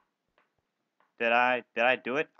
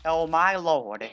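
ordinary talk

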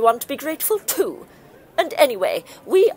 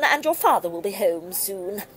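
ordinary talk

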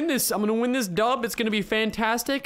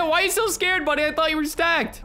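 raised-pitch mimicry of a teasing opponent; opponent's taunt imitated; 8 semitones higher than usual. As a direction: relay the jibe in a higher voice. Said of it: "He said".

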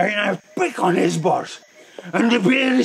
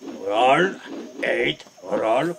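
low, drawn-out practice count; slow practice count; 6 semitones lower, much slower than usual. Shown as a demonstration. Instructor showing the slow nunchaku practice, slow count. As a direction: count it out slowly, each word rising. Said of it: "First, you do practice slow".

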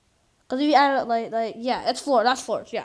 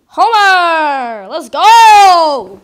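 loud triumphant yell; excited cheer; 3 semitones higher, 15 dB louder, much slower than usual. Real, not acted. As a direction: cheer the team on at full volume. Cheering loudly at the game.

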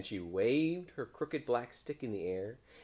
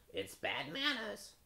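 high, slow, prim crow; 13 semitones higher, slower than usual. A narrator's reading. Crow excusing his silence, high crow voice. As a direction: pitch it way up like a talking bird.

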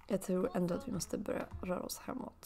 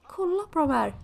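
excited; 8 semitones higher, 8 dB louder than usual. Real, not acted. Showing viewers cute Sims.

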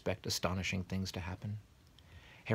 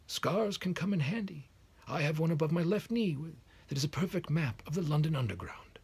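playful higher wizard voice; Dumbledore voice; 10 semitones higher, 3 dB louder than usual. Acted. Dumbledore's whimsical aside.

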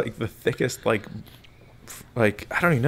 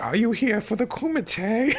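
higher, slow put-on old lady voice; old lady gatekeeper; 10 semitones higher, slower than usual. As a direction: slowly play the sweet old gatekeeper lady, pitched up. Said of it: "She's like".

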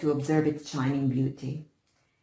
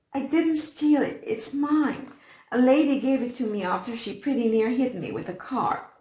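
tearful protest; 7 semitones higher, 4 dB louder than usual. A narrator's reading. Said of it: "still sobbing".

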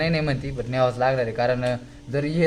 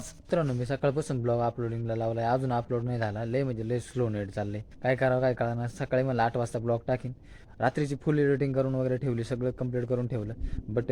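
quiet aside; 2 semitones lower, 12 dB quieter than usual. Real, not acted. Aside to viewers about upload.

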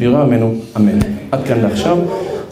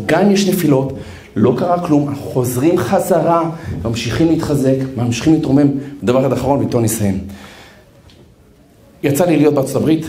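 usual speaking voice

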